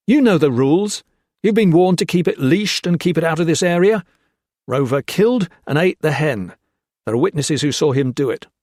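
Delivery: high, loud stern reprimand. Acted. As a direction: lay down the rules firmly. Gray lays down rules.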